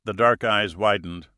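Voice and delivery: plain reading voice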